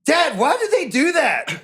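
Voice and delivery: put-on high, slow kid voice asking dad; imitating son. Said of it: "My son ... is like".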